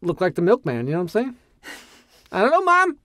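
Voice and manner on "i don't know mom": put-on high whiny child then high mom; high whiny kid voice. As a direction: play a squeaky kid and mom.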